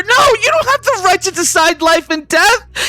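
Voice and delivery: high-pitched, tearful outcry; anguished protest. Acted. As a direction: insist desperately over the other's reply. Grieving wife argues with killer.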